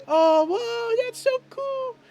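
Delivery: put-on very high-pitched child voice, full of wonder; hammed-up little kid amazement; awestruck kids. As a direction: squeal like a kid seeing magic. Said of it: "some of the kids are like".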